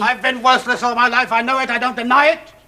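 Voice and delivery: high, loud self-condemnation; loud confession. Acted. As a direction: cry out his worthlessness.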